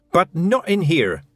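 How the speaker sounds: a slow, firm snap; sharp snap. A narrator's reading. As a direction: bite it off in anger. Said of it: "snapped Tiffany".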